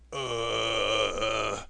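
low, drawn-out groan of pain; stuck man groaning for help in the forest, low groan; deep groan. Acted. Tin Woodman groans to be heard, deep groan. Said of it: "startled to hear a deep groan".

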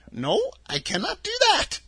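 put-on a sweet little old woman, high and gentle; old witch of the North. A narrator's reading. Witch of the North telling Dorothy her home, high and kindly. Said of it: "she replied".

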